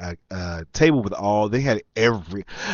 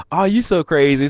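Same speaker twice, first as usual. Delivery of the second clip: high, loud playful woman's voice; imitated flirting woman; 7 semitones higher, 6 dB louder than usual. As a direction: mimic her brushing him off teasingly.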